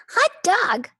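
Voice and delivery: high, loud cheer; excited cry. Acted. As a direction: whoop with joy. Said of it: "cried the class".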